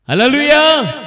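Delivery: loud, high hallelujah call; preacher's rallying cry; praise shout. Real, not acted. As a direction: ring it out to rouse the congregation. Preacher calls congregation to praise.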